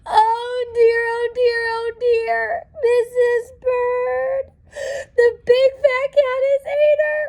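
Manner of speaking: high, tearful wailing lament; crying. Acted. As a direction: wail it out through mock tears. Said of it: "Mister Bird began to cry".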